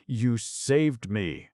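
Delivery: slow, high sobbing words; slow tearful sob. Acted. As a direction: choke it out through tears. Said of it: "sobbed Venice".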